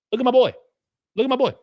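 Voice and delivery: proud hype for a donor's gift; excited hype. Real, not acted. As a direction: show him off to the chat.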